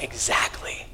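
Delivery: hushed whisper of Jesus. Pretend. Pastor voicing Jesus's gentle reply to a sinner, whispered. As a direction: breathe it softly as Jesus. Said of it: "And Jesus is like".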